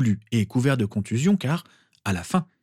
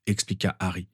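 usual speaking voice

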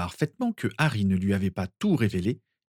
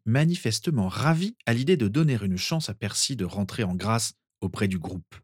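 plain reading voice

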